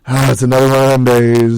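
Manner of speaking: loud, hammed-up groan of resignation; weary mock groan. Student walking out into icy rain, loud mock-weary groan. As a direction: groan it out loudly, playing fed up.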